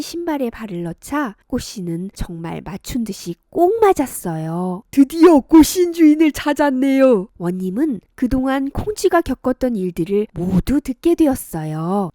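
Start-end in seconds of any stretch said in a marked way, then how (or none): 4.9-7.3 louder, higher pleased announcement; delighted official. Acted. Official finding the shoe's owner, louder delighted voice. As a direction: announce the find with joy.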